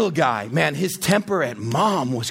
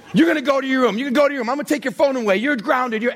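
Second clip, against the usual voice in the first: raised, higher-pitched mock parent scolding; yelled empty threats; 6 semitones higher, faster than usual. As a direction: rattle off threats like a fed-up parent. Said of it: "We just yell threats".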